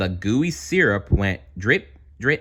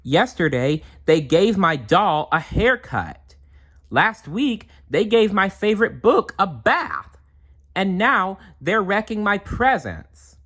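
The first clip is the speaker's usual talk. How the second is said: high, louder aggrieved list; complaining girl; 12 semitones higher, 4 dB louder than usual. Acted. Melinda lists the twins' mischief to the bears, high and upset. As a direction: pile up the grievances like an upset kid.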